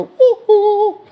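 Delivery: loud, high mock crazy "ooh"; loony whooping. Teller mocks the room with straps, high crazed whoop. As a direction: whoop like a cartoon madman. Mimicking the locked-ward room.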